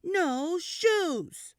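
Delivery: put-on child shouting a refusal. A narrator's reading. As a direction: stamp your foot and yell it.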